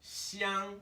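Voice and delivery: xiang held long, way up high; high first tone. Shown as a demonstration. Teacher showing the tone is way up here, high tone. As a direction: stretch xiang on a high level note. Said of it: "is way up here".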